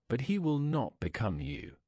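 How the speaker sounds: plain reading voice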